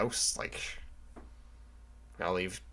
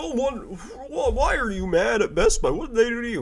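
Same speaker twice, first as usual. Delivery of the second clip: mock whiny viewer; 13 semitones higher, 5 dB louder than usual. Imagined viewer asking why he hates Best Buy, high mocking whine. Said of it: "you're probably like".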